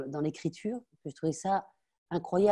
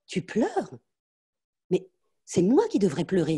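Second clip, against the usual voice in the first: mother scorning tears; 3 semitones higher, 4 dB louder than usual. Acted. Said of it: "dit ma mère".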